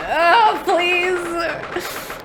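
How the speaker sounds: high drawn-out plea; pleading. Real, not acted. Player begging for the prediction to come true, pleading. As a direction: beg in a high voice, stretching it out.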